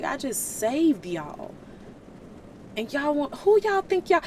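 God fed up with his people; exasperated God. Retold or imitated. God venting to Moses about the Israelites, indignant and rising. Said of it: "He was like".

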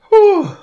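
sharp high exhale at the burn; high whew. Real, not acted. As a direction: blow out the heat with a whew. Reacting to hot wing.

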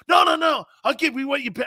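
high, loud emphatic no no no; insistent refusal. Retold or imitated. Retelling how Barry turned down the watch, loud and high.

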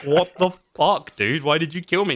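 loud, high-pitched indignant telling-off; mock berating. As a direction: berate them as the wronged companion, hammed-up. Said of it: "he fucking berates you for it".

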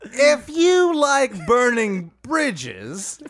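Dwight Howard voice. Acted. Podcaster ending his Dwight Howard joke slow and laughing. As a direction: hold the slow, high voice, then crack up. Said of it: "It's a Dwight Howard thing".

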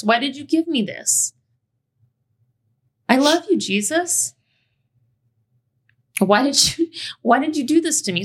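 high, pleading prayer to Jesus; childhood prayer. Retold or imitated. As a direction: beg God like a hurt kid.

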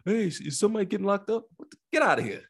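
high mock heckler; squeaky imitation of a nosy onlooker; mocking nosy bystander. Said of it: "people wanna stand outside".

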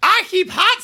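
mock Southern accent. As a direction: put on a fake Southern accent like a politician pandering. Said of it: "talking like a, you know, South".

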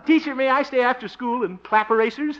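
a hint of a keen little boy; mock school kid. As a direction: play the eager pupil for fun. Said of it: "feels just like a school kid again".

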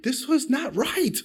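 slow, high, hammed-up indignant whine; mock indignation. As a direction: whine it like a petty wounded child. Indignant at being wronged.

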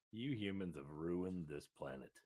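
ordinary delivery

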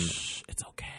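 soft whispered shush; whispering. Pretend. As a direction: hush them gently, unvoiced. Comforting friend, whispered.

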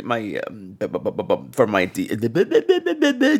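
lightly faked stuttering on bu; playful fake stutter; mock stammer. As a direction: pretend to get stuck on the word. Joking about septum surgery.

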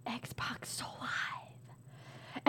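very slow whisper to oneself; whispered sounding-out. Real, not acted. Player puzzling over the card, slow whisper. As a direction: whisper it slowly, testing the sounds.

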